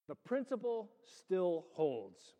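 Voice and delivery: rising, slowing preacher's call; preaching. Acted.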